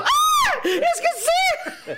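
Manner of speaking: very high, loud shriek, slow and laughing; startled child cry. Retold or imitated. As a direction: yelp like a child scared by fireworks.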